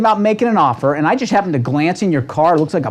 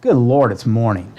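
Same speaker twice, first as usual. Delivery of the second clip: groaning complaint; 6 semitones lower than usual. Retold or imitated. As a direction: dread the day, sink the voice. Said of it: "And other people wake up and say".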